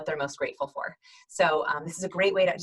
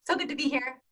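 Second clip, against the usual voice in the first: high, upbeat video-call hello; cheery first-impression welcome; friendly greeting; 9 semitones higher, 3 dB louder than usual. Shown as a demonstration. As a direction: greet the group brightly, pitched well up. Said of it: "your first impression should always be".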